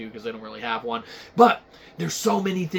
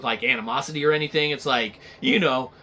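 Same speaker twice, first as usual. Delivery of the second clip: usual speaking voice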